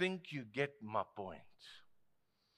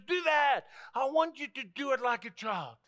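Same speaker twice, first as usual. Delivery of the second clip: high, raised-voice exhortation; emphatic urging; 7 semitones higher than usual. Retold or imitated. Preacher voices what Jesus is saying to us, emphatic. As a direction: urge it with a raised, pleading push.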